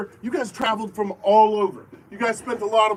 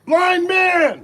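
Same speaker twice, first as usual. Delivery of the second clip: loud, high emergency call; demonstration yell; 7 semitones higher, 6 dB louder than usual. Shown as a demonstration. Host showing players the injury call, yelling it. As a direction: yell it out as an alarm to the whole field. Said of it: "and yell".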